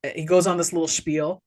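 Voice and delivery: ordinary talk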